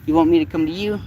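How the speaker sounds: high cooing to a kitty. Real, not acted. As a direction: coax the cat in a high voice. Baby talk to a cat.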